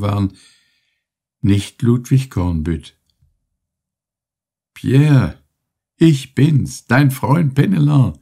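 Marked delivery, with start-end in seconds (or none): 4.7-8.2 put-on higher, louder call to an old friend. A narrator's reading. Penelon calling to his dazed comrade, raised and eager. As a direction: reach him with a raised, eager voice. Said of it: "rief dieser".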